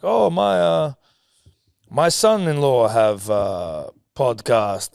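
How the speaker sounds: a hint of a heavy Croatian accent, halting; imitating a Croatian mother-in-law. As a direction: lightly put on Croatian accent, high. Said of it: "heavy Croatian accent".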